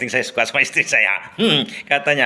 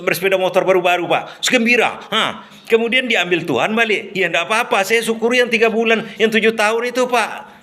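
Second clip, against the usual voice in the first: higher, plain-spoken voice, untroubled; simple, relaxed errand boy; 7 semitones higher than usual. Retold or imitated. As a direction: cheerfully count your blessings. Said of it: "dengan logat yang sederhana sekali".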